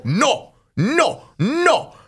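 high-pitched imitated "no", again and again; curt refusal. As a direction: imitate a man refusing everyone. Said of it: "he would just go".

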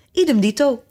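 calling out. A narrator's reading. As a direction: pipe it up as a call. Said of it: "riep Fiedeldop".

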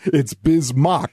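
slow "Bismack" ending like mock; correct pronunciation. Shown as a demonstration. Imagined staffer repeats the correction, "Bismack" as Biz-mock. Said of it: "It's not Bismack".

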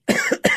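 sudden coughing fit, real; choking cough. Real, not acted. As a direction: cough hard, something went down wrong. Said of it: "I'm choking over here".

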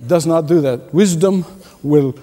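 ordinary talk, nothing marked

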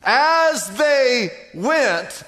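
very slow, stretched and weighted; slow emphatic repeat. Shown as a demonstration. Preacher repeating the key phrase, slow and stretched. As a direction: let each word land heavily. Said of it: "'cause that's faith".

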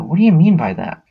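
mock-baffled, pitch jumping up; acted startled question. They react to two Holiday killers, startled.

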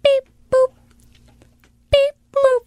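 put-on tiny high toddler chirp; high, cute two-note chirp; little girl's beep boop. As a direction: squeak it out like a small child requesting a song. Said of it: "And she goes".

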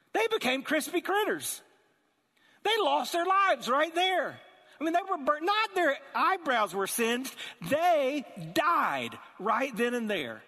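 high, loud, slowing emphasis; emphatic preaching. Acted. Preacher stressing to the church that the guards died, emphatic. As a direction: stretch each word to land the horror. Dramatic climax of story.

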